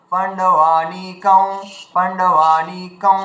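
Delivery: পাণ্ডবানিকং with the va pulled up into the nose and held; slowly nasalise the va of পাণ্ডবানিকং; teacher showing the nasal va; nasalised va. Shown as a demonstration. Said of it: "ওয়াটাকে নাকে তুলে টেনে নিয়ে যেতে হবে".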